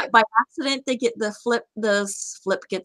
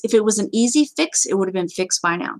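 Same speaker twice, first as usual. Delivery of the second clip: ordinary talk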